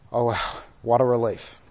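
soft, low, relieved sigh; hushed relief on a breath. Acted. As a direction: sigh it out, letting the tension go. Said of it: "To sigh and say".

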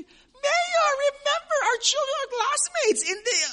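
very high, strained pleading voice; pleading squeak. Retold or imitated. A preacher plays a shamed visitor pleading with the mayor. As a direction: squeak out a desperate plea.

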